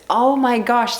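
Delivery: ordinary talk, nothing marked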